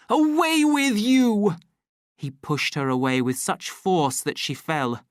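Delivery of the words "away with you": furious dismissal. A narrator's reading. Savage pushing Lenina down.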